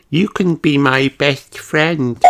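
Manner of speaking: high, slow hopeful piglet voice; hopeful offer. A narrator's reading. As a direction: offer friendship eagerly. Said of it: "says Peppa".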